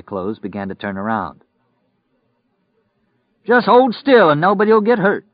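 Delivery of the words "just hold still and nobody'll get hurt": high, loud warning yell; shout. Acted. Robber to store customers at gunpoint, shouting. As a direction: shout out a threat in a raised pitch. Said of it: "Lloyd shouted".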